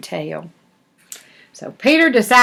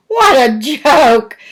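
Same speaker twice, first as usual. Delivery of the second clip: slow, amused and higher, like a giggling breeze; mocking laugh; 5 semitones higher, 6 dB louder, much slower than usual. Breezes mocking Peter's new name, slow and amused. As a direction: savor the joke with a mocking grin.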